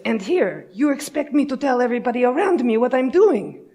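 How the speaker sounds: high, loud mock outrage; hammed-up Russian indignation, pitch climbing; indignant Russian engineer. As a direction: imitate an exasperated Russian, building to a shout. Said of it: "And he goes".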